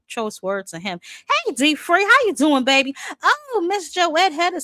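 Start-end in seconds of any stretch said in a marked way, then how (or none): none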